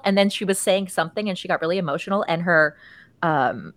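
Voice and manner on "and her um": ordinary talk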